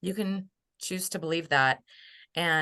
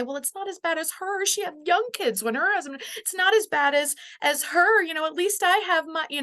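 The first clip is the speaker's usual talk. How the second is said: much higher, put-on self-consoling voice; mock self-comparison; 11 semitones higher than usual. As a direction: mimic someone talking their own pain down. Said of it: "we wanna wish it away and say".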